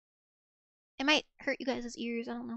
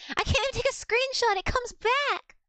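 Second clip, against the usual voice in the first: high-pitched wail of frustration; frustrated, voice shooting way up; exasperated outburst; 10 semitones higher, 4 dB louder than usual. Real, not acted. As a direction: whine loudly at uncooperative tech, dragging out the end. Frustrated by screenshot failing.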